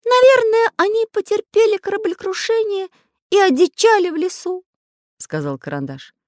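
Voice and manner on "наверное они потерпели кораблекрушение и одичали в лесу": very high, loud storybook character voice; high-pitched, emphatic little figure; voiced cartoon Pencil character, earnest. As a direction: pipe it up high and loud, speculating.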